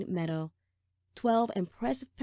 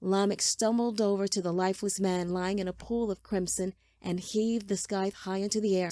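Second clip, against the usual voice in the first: usual speaking voice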